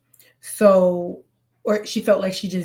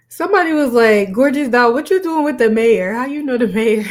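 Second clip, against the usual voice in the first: loud, higher mock-questioning; amused incredulity; 4 semitones higher, 8 dB louder than usual. Reactor repeats a viewer's teasing comment, amused incredulity. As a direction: read it out loud, highly tickled.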